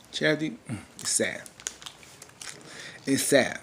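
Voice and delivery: ordinary talk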